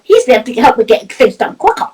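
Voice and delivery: ordinary talk, nothing marked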